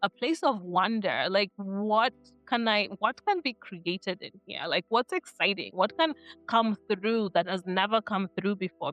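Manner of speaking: ordinary talk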